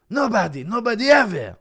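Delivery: loud, high mock outburst; catchphrase imitation. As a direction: belt out the quote like a catchphrase. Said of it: "Certain words said in a certain way".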